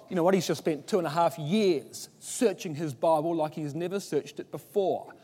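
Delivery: ordinary talk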